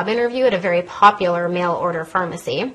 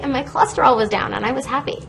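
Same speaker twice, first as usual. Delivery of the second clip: ordinary talk, nothing marked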